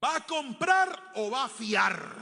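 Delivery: public humiliation. Retold or imitated. Don Damián to the boy before the crowd, slow and loud. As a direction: shame him in front of everyone.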